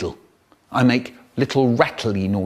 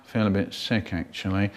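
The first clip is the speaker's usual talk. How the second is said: much softer, lower, sick little voice; queasy murmur; 6 semitones lower, 8 dB quieter than usual. Retold or imitated. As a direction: say it like you're about to throw up. Child feeling carsick.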